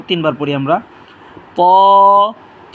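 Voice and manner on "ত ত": ত with a heavy, rounded sound; thick, heavy. Shown as a demonstration. Teacher models a letter for learners, thick and heavy. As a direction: make ত thick and full. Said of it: "মোটা করে দিয়ে পড়বো".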